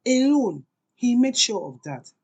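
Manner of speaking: plain reading voice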